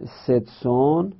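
ستسون drawn out slowly syllable by syllable; slow spelled form. Shown as a demonstration. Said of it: "یعنی برای". (ستسون آهسته و کشیده)

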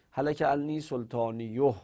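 سلطانیوه ending in "-iyoh"; damma ending. Shown as a demonstration. Teacher has students compare which is easier, damma ending. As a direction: say سلطانیوه with a rounded o before the final h.

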